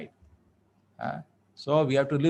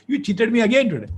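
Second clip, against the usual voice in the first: loud, high-pitched indignant complaint; mock accusation; 10 semitones higher, 7 dB louder than usual. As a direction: accuse him in hammed-up outrage. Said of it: "She gets up and says".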